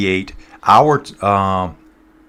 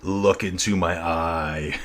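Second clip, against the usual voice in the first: slow, low and softer menace; menacing movie line; 3 semitones lower, 6 dB quieter, much slower than usual. Retold or imitated. Quoting a villain line to chat, slow lowered menace. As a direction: drag it out slow and ominous. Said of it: "There's that one".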